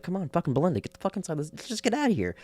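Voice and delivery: put-on a kid itching to leave; excited Doctor. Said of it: "And he's like".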